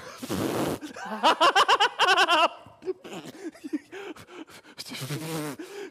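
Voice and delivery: lautes, hohes, höhnisches Gelächter des Riesen; Lachkrampf. Acted. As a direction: lach dich schallend über den Jungen kaputt. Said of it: "Der kriegt einen Lachkrampf". (Goliath lacht David laut und höhnisch aus)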